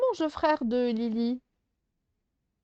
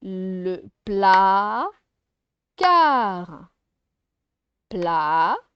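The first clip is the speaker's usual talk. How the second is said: PLA and CAR loud, long, split by pauses; syllable by syllable; 3 semitones lower, 6 dB louder, much slower than usual. Shown as a demonstration. Teacher showing pupils the word placard, syllable by syllable. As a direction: break PLACARD into two stretched syllables. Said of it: "pour les syllabes".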